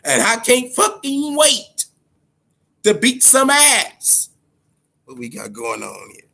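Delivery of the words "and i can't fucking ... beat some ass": high, slow, relishing threat; eager hype. Real, not acted. Telling viewers he'll pre-order UFC 4, high eager hype. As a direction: savor every word, can't wait.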